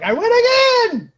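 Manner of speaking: very high, loud triumphant squeal; gleeful win. Retold or imitated. After she walks away, crowing very high and loud. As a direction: squeal the victory like a kid. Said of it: "And I'm like".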